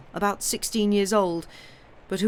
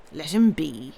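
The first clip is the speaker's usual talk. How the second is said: a lightly voiced dignified general; a hint of an old general's dignity; 4 semitones lower, slower than usual. A narrator's reading. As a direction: settle it with calm authority. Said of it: "the general repeated".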